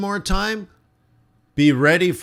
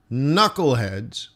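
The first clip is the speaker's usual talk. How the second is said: usual speaking voice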